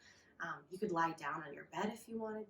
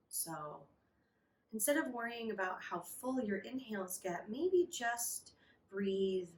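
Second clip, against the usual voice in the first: guided meditation. Acted. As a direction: ease them into calm, breath by breath.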